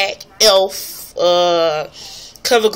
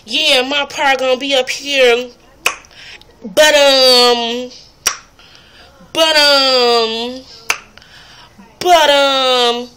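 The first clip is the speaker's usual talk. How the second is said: higher, louder mocking imitation of a girl stalling with "but, um"; imitating her "but, um"; 5 semitones higher, 3 dB louder, much slower than usual. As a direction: mimic her hesitant filler, pitched up. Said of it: "what kept distracting me with her videos is this".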